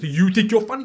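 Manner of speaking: high, very loud incredulous question; hammed-up indignant comeback; loud retort. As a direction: throw the challenge back sharply. Challenge thrown back loudly.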